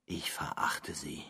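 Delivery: leiser, gepresst geflüstert; leise Verachtung. Acted. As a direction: zische die Verachtung kaum hörbar. Bittere Verachtung. (flüsternde Verachtung)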